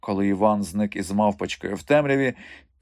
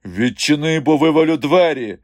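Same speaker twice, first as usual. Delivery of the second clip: louder, higher demand through a door; threatening shout; 6 semitones higher, 7 dB louder than usual. A narrator's reading. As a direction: shout it as an ultimatum. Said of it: "ще раз повторив Іван". (погрозливий гучний крик)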